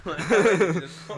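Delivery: loud, high, through laughter; laughing. Real, not acted. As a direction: laugh the words out. Laughing at joke.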